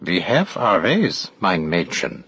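slow, hammed-up German accent; teasing stage-German accent, drawn out; mock German accent. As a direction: put on a knowing German interrogator's accent. Narrator's character jokes in mock German.

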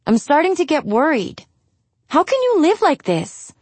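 high, mildly anxious pleading; worried daughter. A narrator's reading. Daughter confronting mother about the bad smell, worried. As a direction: plead with concern, pitch climbing high.

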